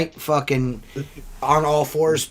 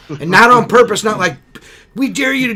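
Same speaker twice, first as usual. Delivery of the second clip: much louder, higher insistence; emphatic; 9 semitones higher, 10 dB louder than usual. Real, not acted. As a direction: stress it wasn't on purpose. Emphatic denial.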